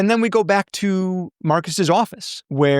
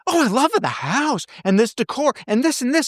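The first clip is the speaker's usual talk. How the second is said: a much higher voice, gushing over the decor; gushing, high and sweet; 9 semitones higher than usual. Retold or imitated. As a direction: gush with delight, way up high. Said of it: "instantly she's like".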